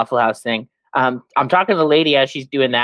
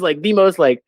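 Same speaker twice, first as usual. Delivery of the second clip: ordinary talk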